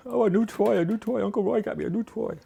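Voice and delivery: high, eager voice for the dog; excited dog voice. Retold or imitated. Her dog thrilled about Uncle Roy's new toy, high and eager. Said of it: "Oh, she was all excited".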